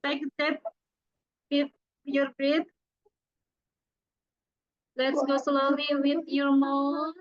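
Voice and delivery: a gentle breathing-exercise leader; guided breathing. Acted. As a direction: guide the group through each breath.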